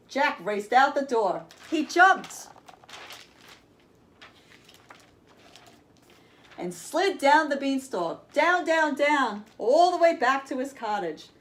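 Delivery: put-on lively, bright read-aloud for kids; storybook reading. A narrator's reading. Reading Jack and the Beanstalk to children, lively storyteller voice. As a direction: tell it like a bedtime tale with big moments.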